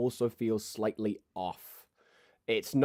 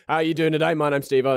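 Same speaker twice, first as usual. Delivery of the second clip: eager loud greeting; 6 semitones higher, 6 dB louder, faster than usual. Acted. Steve-O greets loudly.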